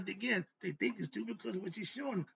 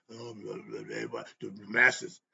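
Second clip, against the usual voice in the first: lower, slow, sneering parody of their brush-off; mocking dismissal; 9 semitones lower, slower than usual. Mocking the Masons who dismiss the masses, lower and slow. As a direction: drawl it out like you're mocking an excuse.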